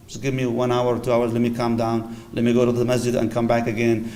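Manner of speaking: a quiet, tender request for a timeout; gentle plea. Retold or imitated. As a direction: soothe an upset spouse, asking for time. Calm plea to upset spouse.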